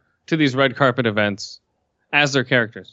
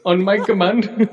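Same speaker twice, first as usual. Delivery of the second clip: mock interviewer; 6 semitones higher than usual. Acting out a clumsy interview.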